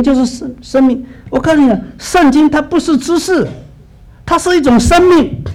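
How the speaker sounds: impassioned pulpit cry that life is not knowledge; fervent preaching. Acted. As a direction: preach it with rising fire. Preaching builds to full force.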